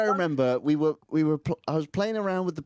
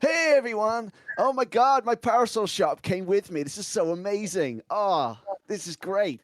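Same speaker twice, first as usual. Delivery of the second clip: higher, gushing streamer voice; 3 semitones higher than usual. Acted. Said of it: "This is the voice I'm doing for the stream".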